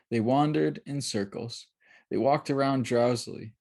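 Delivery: ordinary delivery, nothing marked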